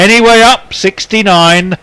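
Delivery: loud, drawn-out bingo call; bingo caller. Pretend. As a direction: belt the number out to the whole hall. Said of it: "you're really putting in an effort here".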